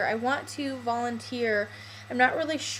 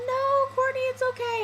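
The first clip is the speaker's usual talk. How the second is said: much higher, soothing mock instructor; imitating reassuring instructor; 13 semitones higher than usual. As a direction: comfort her like the instructor did. Instructor comforts her.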